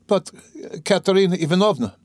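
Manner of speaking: high, anxious question; pitched high with worry; worried. A narrator's reading. Sonia frets about Katerina Ivanovna, high anxious question.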